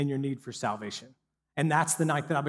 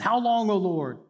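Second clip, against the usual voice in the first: psalm lament; 6 semitones higher, 4 dB louder than usual. Retold or imitated. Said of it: "we quote David from the Psalms".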